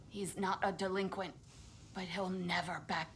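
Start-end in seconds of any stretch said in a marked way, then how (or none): none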